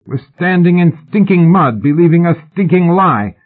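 high, loud protest; outburst. A narrator's reading. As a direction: burst out against the lie. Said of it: "Brian burst out".

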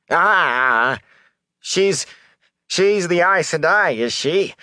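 high, halting suspicion; agitated peddler. Acted. Peddler realising who the woman is, agitated and suspicious. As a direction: stammer into an accusing question. Peddler reacts to Aes Sedai.

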